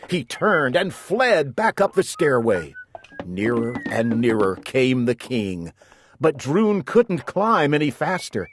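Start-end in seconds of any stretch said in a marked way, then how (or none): none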